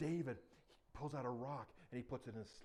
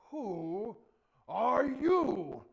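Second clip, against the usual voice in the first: very loud, drawn-out challenge, pitched up; booming giant; 8 semitones higher, 17 dB louder, much slower than usual. Acted. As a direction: roar the question slowly at a tiny foe. Giant booms at David.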